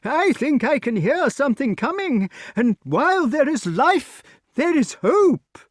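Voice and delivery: high, loud, eager king; excited old king. Acted. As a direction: cheer them on in a high voice. Pellinore voice.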